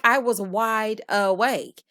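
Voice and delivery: slow, drawn-out quoted insistence; imitating someone spelling it out slowly; emphatic retort. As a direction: stretch out "wide awake" to insist. Acts out her insistent rebuttal.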